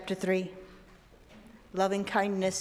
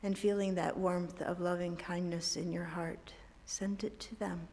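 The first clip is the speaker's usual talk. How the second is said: guided meditation; 7 dB quieter than usual. Acted. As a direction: guide them gently into calm. Leads a meditation.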